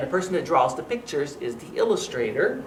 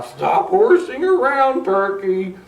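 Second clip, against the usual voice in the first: high, loud silly cow voice; 4 semitones higher, 6 dB louder than usual. Acted. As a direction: scold like a bossy cow. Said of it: "said the cow".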